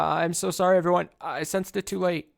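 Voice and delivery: high, loud, distressed apology. Acted. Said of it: "lets out a cry of distress".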